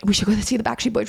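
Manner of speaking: quick, eager plea to a friend; eager manifesting. Retold or imitated. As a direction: rattle it off like a wish you're manifesting. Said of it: "I was like".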